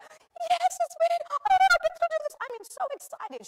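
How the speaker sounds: very high, loud, fast squeal; thrilled wife. Retold or imitated. Wife thrilled to rappel, squealing very high. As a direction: burst with excitement. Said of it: "She's like".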